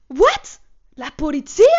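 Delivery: shocked what. Retold or imitated. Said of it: "J'ai fait".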